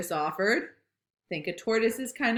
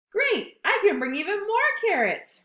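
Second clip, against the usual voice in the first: high, louder gleeful voice; delighted at more carrots; high eager Rabbit; 12 semitones higher, 5 dB louder than usual. Acted.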